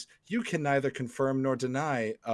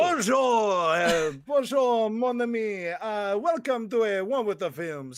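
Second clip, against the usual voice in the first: high, loud hammed-up French accent; a big, silly French host greeting; 11 semitones higher, 6 dB louder, slower than usual. Host greeting the audience, in a hammed-up French accent. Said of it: "intro in a French accent".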